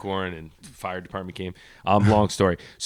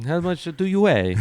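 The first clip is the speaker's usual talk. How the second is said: hammed-up Italian man, put-on voice; mock Italian-guy voice; 9 semitones higher than usual. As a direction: exaggerate the fake Italian instructor. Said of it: "You just lowered your voice".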